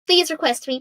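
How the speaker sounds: ordinary delivery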